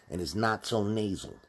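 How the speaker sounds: ordinary talk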